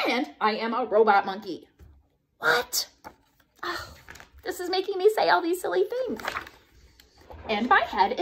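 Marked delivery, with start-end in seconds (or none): none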